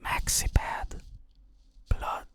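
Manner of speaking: short clipped whispered words. Retold or imitated. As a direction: whisper it to a neighbour in the dark. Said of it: "kept whispered to that".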